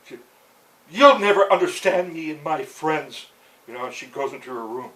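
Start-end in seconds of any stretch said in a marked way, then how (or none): 0.9-3.3 much louder, higher accusing shout; loud bitter shout; furious outburst. Retold or imitated. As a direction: hurl it at him before storming off. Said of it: "she just glares at him".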